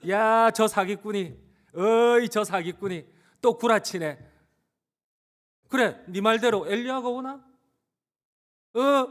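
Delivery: loud, high-pitched heckling; scornful accusing. Acted. Crowd calling Jesus a fraud, scornful. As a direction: shout out the taunt, rising at the end. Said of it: "주님을 향해서 사기꾼이라고 합니다".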